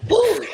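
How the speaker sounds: high, loud emotional whoop. Real, not acted. Overwhelmed by emotion with Conscious, high whoop. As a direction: let out a high cry. Emotional outburst mid-farewell.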